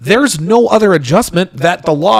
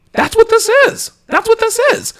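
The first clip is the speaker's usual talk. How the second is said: high gloating. Acted. Gloating that men win.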